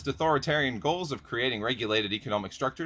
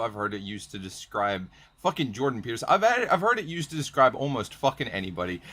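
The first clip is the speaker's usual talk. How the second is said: usual speaking voice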